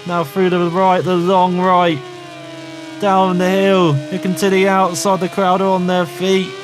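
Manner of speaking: high, urgent play-by-play; excited race commentary. Acted. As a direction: call the race live, pitched high and building to the win.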